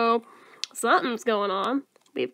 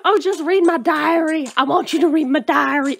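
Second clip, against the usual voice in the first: put-on louder, hammy drawling accent; mocking accent; 3 semitones higher, 4 dB louder than usual. Player mocking the diary invitation, exaggerated drawling accent. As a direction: parody her eager invitation in accent.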